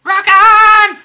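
screaming it like a rocker; rock shout. Pretend. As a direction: belt it out at a metal show. Hair metal cheer after "Hair metal, man.

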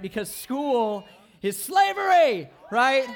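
loud, slow, hammed-up protest; mock outraged shout. Youth pastor shouting that school is slavery, hammed up. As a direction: shout it like a wronged kid, slowly.

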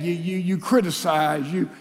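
normal talking voice

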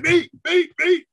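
high, loud, eager mock shout. Client bounds on stage to share her experience, eager shouting. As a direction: shout it like an over-keen volunteer.

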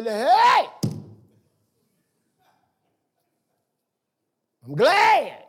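loud, high whoop of relief; mock celebration. Pastor answering a caller about departed members, hammed-up glee. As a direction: cheer it out, playing up the gladness. Acted celebration that someone left.

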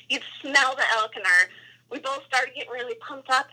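ordinary talk

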